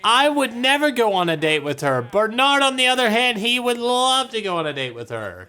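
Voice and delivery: high, loud teasing; mocking. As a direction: tease him about his crush. Mocking the character's denial.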